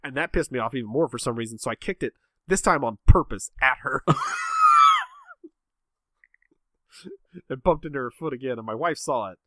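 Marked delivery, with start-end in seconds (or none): 4.1-5.1 high shrieking laugh; loud burst of laughter. Real, not acted. Dad laughing at his own bad moment, shrieking laugh.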